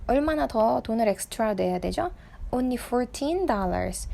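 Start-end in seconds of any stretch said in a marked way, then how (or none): 2.5-4.1 put-on fourteen said slowly with the weight on teen; teacher stressing teen in fourteen for students. Shown as a demonstration. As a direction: lean on the teen in fourteen, slow and clear. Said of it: "Fourteen 할 때 teen 강세가 있는 거예요".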